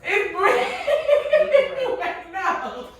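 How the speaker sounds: high-pitched laugh through words; cracking up mid-sentence. Real, not acted. Still laughing at bread.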